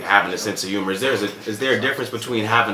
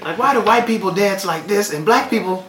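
high, loud hack comedian bit; bad comic voice; 14 semitones higher, 7 dB louder than usual. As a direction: play a corny stand-up on stage. Mocks a bad stand-up joke.

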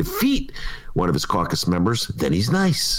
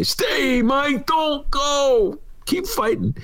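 high, drawn-out imploring cry; hammed-up desperate appeal; mock pleading; much slower than usual. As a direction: beg him in a high voice. Acting out pleading with Madigan.

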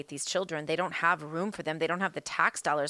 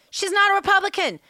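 angry; 10 semitones higher, 8 dB louder than usual. Real, not acted. Angry rant.

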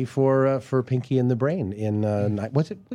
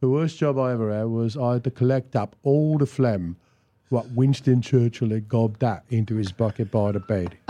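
lower, lazy Cockney storyteller; Cockney accent; 5 semitones lower than usual. Acted. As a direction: spin a filthy yarn in Cockney. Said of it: "we do Derek and Clive".

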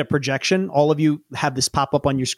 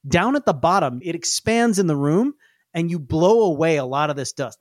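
ordinary delivery

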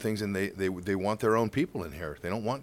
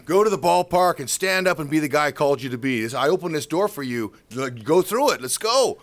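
loud, raised exhortation as God; rousing command; 4 semitones higher, 7 dB louder than usual. Retold or imitated. God sending him to ballpark, commanding. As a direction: urge him on like a coach.

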